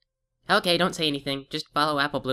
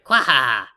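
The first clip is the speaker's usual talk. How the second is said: evil laugh; 3 semitones lower, 6 dB louder than usual. Acted. Villain laughs again.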